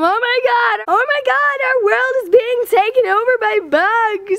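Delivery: high, hammed-up alarm; mock panic. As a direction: wail it high as if bugs are invading. Mock panic at bugs everywhere.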